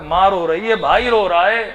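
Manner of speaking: loud, high lament over the weeping family; full-force mournful sermon cry; impassioned preaching. Acted. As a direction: cry it out to the congregation. Preacher evokes grieving family.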